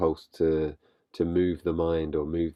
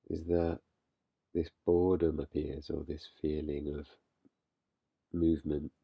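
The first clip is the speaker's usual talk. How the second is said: calm, unhurried guiding voice with long pauses; guided meditation; 4 dB quieter than usual. Real, not acted. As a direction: gently lead listeners into stillness, leaving space between phrases. Leads a meditation.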